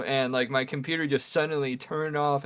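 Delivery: ordinary delivery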